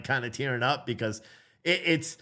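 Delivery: ordinary delivery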